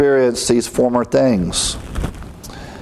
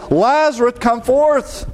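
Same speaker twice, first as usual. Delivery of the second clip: high, projected call, not truly shouted; crying out; 11 semitones higher than usual. A narrator's reading. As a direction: call him out in a high, raised voice. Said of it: "he cried with a loud voice".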